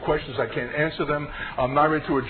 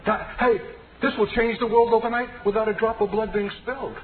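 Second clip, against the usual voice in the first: high, elated outburst of discovery; joyful cry; 10 semitones higher than usual. Retold or imitated. A teacher recalling his sudden revelation, high excited cry. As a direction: cry out like you just found the answer. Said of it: "there's a cry that went up".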